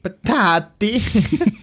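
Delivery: put-on Patati stretched slowly in a high Italian accent. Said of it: "in an Italian accent".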